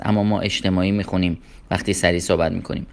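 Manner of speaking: usual speaking voice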